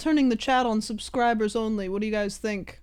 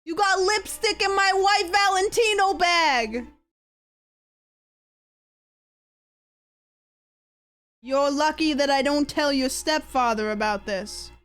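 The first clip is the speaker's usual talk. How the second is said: put-on Greek accent; 5 semitones higher than usual. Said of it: "This is a Greek accent".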